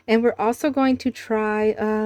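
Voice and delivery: normal talking voice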